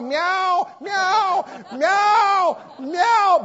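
high, plaintive mock meows; cat meowing. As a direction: mew like a begging stray cat. Cat begging after feeding stops.

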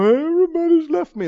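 high whiny mock wail; mock self-pity. Preacher mocks a self-pitying believer with a high whine. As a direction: send up a pitiful moaner. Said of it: "don't get to crying and go".

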